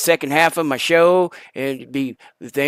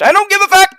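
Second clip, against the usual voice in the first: defiant shout; 7 dB louder, much faster than usual. Real, not acted. Host yelling defiance at his audience, loud and high.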